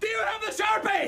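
very high, urgent yell; pretend labour shout. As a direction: yell the question mid-push. Yelled mid pretend delivery.

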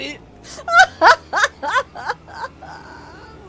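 rire aigu et fort; éclate de rire; fou rire. Real, not acted. Rit de l'ivresse jouée. (grand fou rire)

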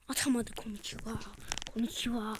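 soft unvoiced whisper. Real, not acted. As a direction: hush it out. Fısıltı. (fısıltıyla)

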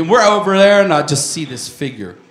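normal talking voice

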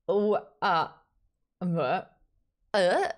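ordinary talk

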